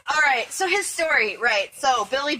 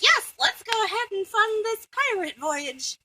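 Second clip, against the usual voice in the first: much higher, a different voice; lightly voiced keen backer; high put-on voice; 10 semitones higher than usual. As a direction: chirp it like an eager Muppet. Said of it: "they're like".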